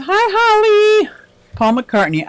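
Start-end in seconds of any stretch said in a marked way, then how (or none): 0.0-1.1 very high, slow, drawn-out hello; sing-song greeting. Pretend. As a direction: greet like a squeaky cartoon character. Reading a chat greeting aloud.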